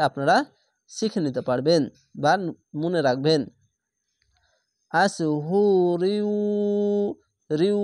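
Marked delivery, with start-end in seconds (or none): 6.0-7.8 রিউ said with a held nasal hum; ghunna nasal. Shown as a demonstration. Teacher showing students how the ghunna should sound, nasal hum. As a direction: let রিউ ring through the nose. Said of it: "এভাবে গুন্না হবে".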